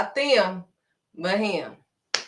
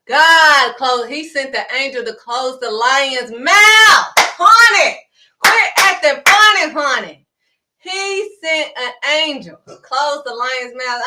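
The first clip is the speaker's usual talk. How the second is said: loud, higher preaching, slowing to drive the point in; full-force preaching; 4 semitones higher, 12 dB louder, slower than usual. Acted. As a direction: preach it out to the congregation.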